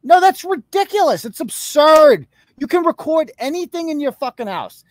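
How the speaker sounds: high, incredulous outburst; it's absurd, stretch it out; exasperated. Real, not acted. Ranting about absurd charges.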